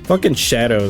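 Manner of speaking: usual speaking voice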